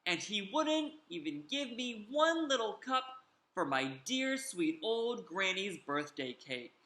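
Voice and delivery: usual speaking voice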